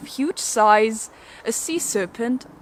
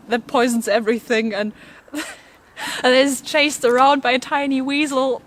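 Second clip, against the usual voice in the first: amused; 3 semitones higher than usual. Real, not acted. Host barely holding laughter about the weasel, giggly. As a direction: fight back laughter while telling it.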